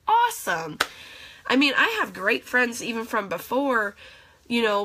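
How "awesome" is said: excited awesome. Real, not acted. Gushing about new friends.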